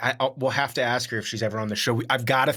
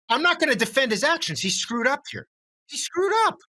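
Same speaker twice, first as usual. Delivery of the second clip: way higher, emphatic give-in; squeaky, exaggerated admission; high-pitched concession; 14 semitones higher than usual. Real, not acted. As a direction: throw your hands up, pitched high. Conceding in playful debate.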